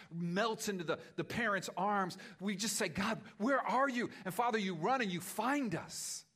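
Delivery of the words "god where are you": high, loud desperate call; crying out to God. Acted. Pastor voicing the congregation's cry to God, loud and high. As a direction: cry out from the heart.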